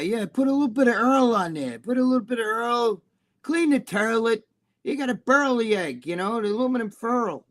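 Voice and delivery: hammed-up Bronx Brooklyn voice, "turlet", "furl"; Brooklyn accent imitation. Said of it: "Jimmy the Bull will always say".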